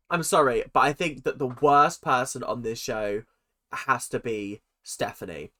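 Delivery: usual speaking voice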